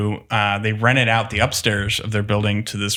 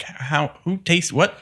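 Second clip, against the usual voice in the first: mock disbelief; 8 semitones higher, slower than usual. Distiller recalling his shock at articles abroad, incredulous. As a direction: play incredulous amazement. Reacting to world press coverage.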